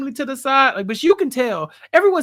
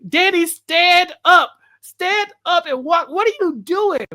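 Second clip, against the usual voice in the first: higher, louder, slow yell at a TV character; yelling at the screen; 6 semitones higher, 5 dB louder than usual. Retold or imitated. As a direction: slowly shout "stand up" at the screen. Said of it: "I'm yelling at the screen".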